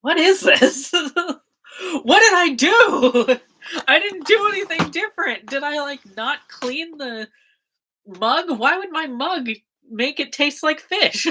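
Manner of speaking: laughing bewilderment. Retold or imitated. Acted confusion at fish-tasting coffee.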